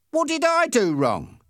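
high, loud protesting question; indignant protest. A narrator's reading. Passenger challenging the policeman's threat, indignant protest.

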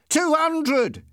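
very high, loud yell; excited shout. A narrator's reading. Passenger hitting top speed with the driver, excited shout. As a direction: shout it in high-pitched triumph. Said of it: "my passenger shouted".

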